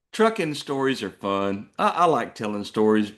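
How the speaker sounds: usual speaking voice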